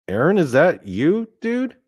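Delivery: mild disbelief, climbing very high on the last word; incredulous question. Retold or imitated. Dreamer recognising the dead kid in a stranger, incredulous. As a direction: ask it as if you can barely believe who it is.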